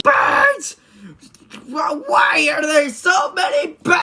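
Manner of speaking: hammed-up terror at the swarm; panicked scream.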